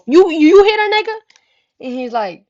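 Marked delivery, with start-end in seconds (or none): 0.1-1.2 loud, high-pitched confrontation; retold outraged yell; outraged accusation. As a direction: shout it as a stuttering accusation.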